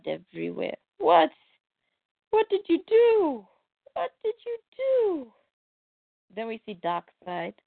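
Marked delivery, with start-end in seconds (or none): 1.0-5.3 high anguished demand. Acted. Scott confronts over bloody body.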